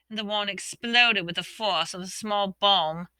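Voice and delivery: ordinary delivery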